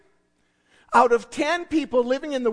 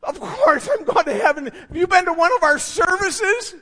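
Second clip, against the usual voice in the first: louder, higher, cocky and sure; smug churchgoer; 4 semitones higher, 6 dB louder than usual. Retold or imitated. Churchgoers assuring the preacher they are bound for heaven, smug. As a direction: answer like it's obvious.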